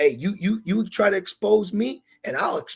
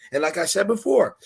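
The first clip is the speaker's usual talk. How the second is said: usual speaking voice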